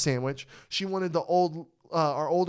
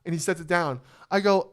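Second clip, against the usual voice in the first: ordinary talk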